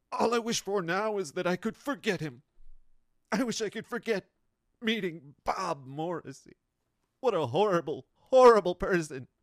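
loud, high, overwrought wailing; melodramatic lament. Acted. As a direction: ham up a trauma-dumping rant, voice straining. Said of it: "who's like".

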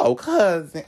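fake-sweet "Oh, cousin." as he'd never say it; imitating a phony cousin call; mock cousin greeting. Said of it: "I'm not gonna be like".

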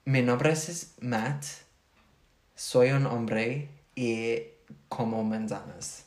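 "Mi nombre es Matt" slowed and careful, each word spaced; careful Spanish. Shown as a demonstration. Learner remembering her Spanish line, slow and careful. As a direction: recite it slowly like a learner. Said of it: "Oh, I remember it".

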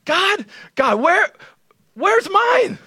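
hammed-up lonely Adam begging God; lonely Adam complaining. Adam asking God where his mate is, high mock whine. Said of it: "And I'm like".

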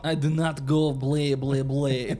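put-on slow, loud vampire accent; Dracula voice. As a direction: ham up a cartoon vampire. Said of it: "that's Dracula".

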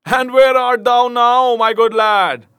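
loud, high taunting shout; roaring jeer from the bridge; laughing shout. A narrator's reading. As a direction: bellow it gleefully across the water. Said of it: "shouted the stranger, roaring with laughter".

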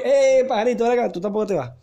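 high, loud hail, easing off; calling someone back. Acted. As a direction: call out to stop someone sneaking off. Guard calls out to stop him. (llamando en voz alta para detenerlo)

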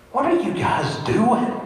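high-pitched, drawn-out "what are you doing?"; incredulous question. Acted. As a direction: ask slowly and high, as if baffled. Acting out question to gazing disciples.

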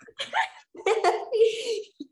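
long, high peal of laughter; cracking up. Real, not acted. Amused by her own joke.

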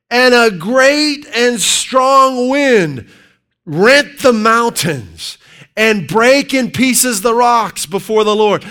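high, loud, slow scripture reading; preaching scripture. Acted. Preacher reading First Kings aloud at full force. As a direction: thunder out the verse.

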